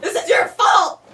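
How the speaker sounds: loud hammed-up yelling, laughing underneath; mock furious yell. Wife in labour blames her husband, mock furious yelling. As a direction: bark blame at him in fake rage.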